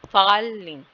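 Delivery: Falling punched loud on फॉ, pitch falling away; stress on first syllable. Shown as a demonstration. Teacher showing the stressed syllable, loud falling stress on falling. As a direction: hit फॉ hard, then drop on लिंग. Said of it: "ए जो है वो stress syllable है".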